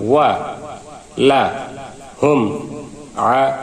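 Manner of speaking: one syllable at a time with pauses; syllable-by-syllable drill reading; broken syllables. Shown as a demonstration. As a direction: say each syllable separately, pausing between. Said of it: "আমরা এখন ভাঙা ভাঙা উচ্চারণ করব".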